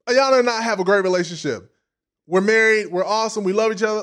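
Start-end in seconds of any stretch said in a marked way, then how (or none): none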